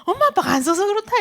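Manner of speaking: put-on high-pitched know-it-all kid; younger sibling. Little brother explains to his sister why money seems short, cocksure and high. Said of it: "내 동생이 뭐라면".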